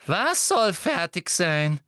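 slow, high, insistent question; urgent pressing. A narrator's reading. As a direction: press hard, stretching the words. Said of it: "drängte Umbridge".

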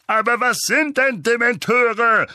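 high, loud, exasperated question; Onkel Vernon, furious. A narrator's reading. Uncle demands what Dementors are, furious. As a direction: snap the question at high volume. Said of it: "fragte Onkel Vernon fuchsig".

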